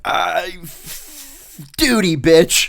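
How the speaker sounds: high, very loud drawn-out shout; hammed-up superior handing down punishment; mock bellowed order. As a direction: stretch the start, then blast the punchline.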